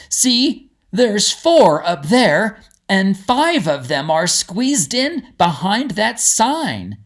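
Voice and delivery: a bystander calling attention to the birds; onlooker in the crowd. Acted.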